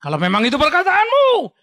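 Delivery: high, loud eager shout of many; crowd shouting back. Acted. The people of Israel answering Joshua, loud shout. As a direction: yell it as a whole people answering. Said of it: "Orang Israel langsung teriak".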